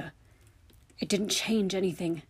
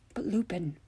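ordinary delivery

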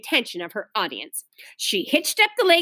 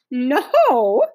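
firm, louder no; flat refusal; 5 dB louder than usual. A narrator's reading. Ramona refuses to give up her ears, flatly. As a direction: refuse it outright, a bit loud. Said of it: "said Ramona flatly".

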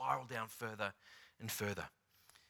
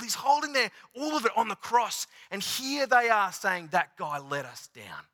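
higher, louder building sermon delivery; fervent preaching; 7 semitones higher, 4 dB louder than usual. Acted. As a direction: preach it with rising conviction.

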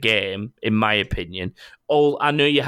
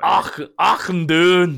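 high, loud, drawn-out Scottish cry; Scottish dwarf accent; 8 semitones higher, 5 dB louder, much slower than usual. Acted. Can't help making the Warcraft reference, Scottish dwarf holler. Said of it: "he's got a Scottish accent".

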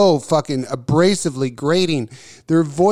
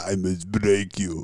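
very low, slow, quiet villain impression; Ivan Drago; 11 semitones lower, 8 dB quieter than usual. Host mocking co-host's stare, in a deep movie-villain voice. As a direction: drop deep and slow, an understated menacing threat. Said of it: "looking at me like Ivan Drago".

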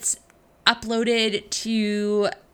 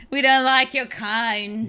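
slow, louder mock snub; mock disdain; 4 dB louder, slower than usual. Co-host playfully shunning an AWS shop worker, mock disdain. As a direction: look down on them in jest.

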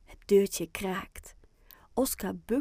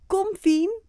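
high, loud summons; calling out; 12 semitones higher, 8 dB louder than usual. A narrator's reading. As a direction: call a friend to come along. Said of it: "roept Oscar". (hard geroepen)

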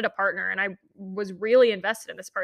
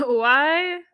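drawn-out higher, louder why; baffled; 6 semitones higher, 6 dB louder than usual. Retold or imitated. Woman reacting to boyfriend's messages, baffled.

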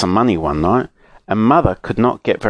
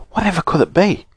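high, wondering question; mildly alarmed, raised pitch; startled; 9 semitones higher, faster than usual. A narrator's reading. Joe wondering about the screech outside, startled.

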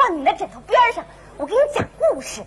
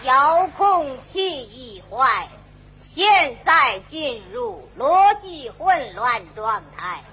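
slow robot voice; 6 semitones lower, much slower than usual. Acted. Robot malfunction announcement.

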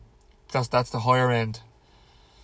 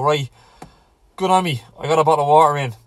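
louder, fast hammed-up pat on the back; mock self-praise; 3 semitones higher, 8 dB louder, faster than usual. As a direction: cheer yourself on for a tiny win, half-mocking. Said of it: "You might have to go".

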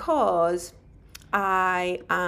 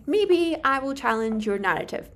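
ordinary talk

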